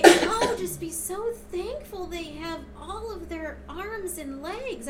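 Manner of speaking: a lightly voiced high grandma; grandma's advice. Grandma reminding her to be thankful, mildly high. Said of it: "And then she'd say".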